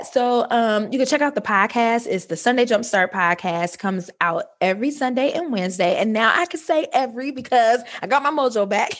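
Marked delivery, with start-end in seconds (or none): none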